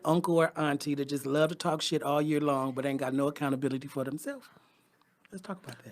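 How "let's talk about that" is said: soft unvoiced whisper; hushed aside whisper. Real, not acted. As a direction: drop to a whisper. Hushed aside after rant.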